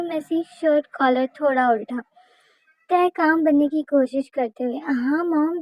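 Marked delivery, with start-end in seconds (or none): none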